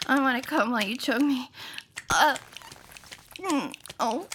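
usual speaking voice

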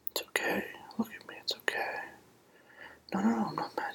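usual speaking voice

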